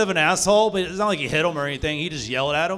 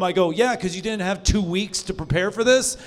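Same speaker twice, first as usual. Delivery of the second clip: ordinary talk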